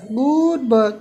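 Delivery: "Good bird" lifted and carrying, like cooing to a pet; coaxing praise. Real, not acted. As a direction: call "Good bird" up to the bird to lure it.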